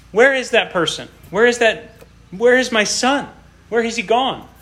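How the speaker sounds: high, ringing preacher's lament; impassioned preaching. Acted. Preacher asking the congregation where David went, high and impassioned. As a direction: plead with the flock as if God is searching.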